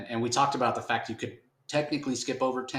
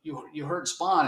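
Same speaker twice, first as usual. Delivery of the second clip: upset friends; 6 semitones higher than usual. Retold or imitated. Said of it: "Spawn's friends are like".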